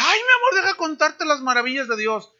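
very high, sing-song gushing; gushing wife. Retold or imitated. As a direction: coo it like an overexcited churchgoer. (esposa que llega emocionada, voz muy aguda y melosa)

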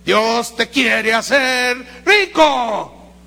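very slow mock preacher's promise, stretched out; drawn-out mock prosperity-preacher line. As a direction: parody the prosperity gospel. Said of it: "¿Saben qué está predicando?".

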